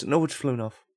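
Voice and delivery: ordinary delivery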